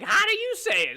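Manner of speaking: mocking American. Americans correcting his pronunciation.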